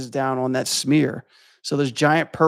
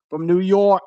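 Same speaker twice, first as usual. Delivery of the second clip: put-on New York accent; 5 semitones higher, 4 dB louder than usual. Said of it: "I sound like I'm from Boston".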